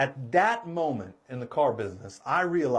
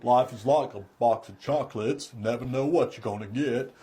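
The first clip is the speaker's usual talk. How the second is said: Forrest Gump; 4 semitones lower than usual. Shown as a demonstration. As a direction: do a folksy Southern simpleton voice. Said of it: "I could do Forrest Gump really well".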